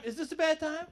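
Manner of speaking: timid visitor. Retold or imitated. Guest noticing crying kids, timid high question. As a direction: ask it in a small unsure voice.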